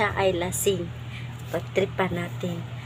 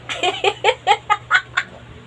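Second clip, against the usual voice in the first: giggle; 12 semitones higher, 3 dB louder than usual. Real, not acted.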